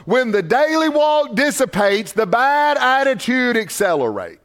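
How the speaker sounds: "When the daily walk dissipates" rung out high and slow, a hammered motto; slogan preached high. Acted. Pastor giving the church a line to write in their Bibles, slow and preached. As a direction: preach the motto slowly so they can write it down. A statement you might wanna write down.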